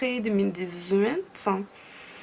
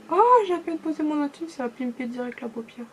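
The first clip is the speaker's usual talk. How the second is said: real surprise; 6 semitones higher than usual. Real, not acted. Vlogger barely touches tool to eyelid, amazed. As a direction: exclaim it, pitch leaping up. Amazed by instant strong pigment.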